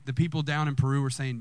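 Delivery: usual speaking voice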